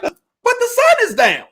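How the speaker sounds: high indignant objection; high mock protest; mock scandalized spouse. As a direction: squeal it up high in mock horror. Mocking Sabbath-keeping spouse.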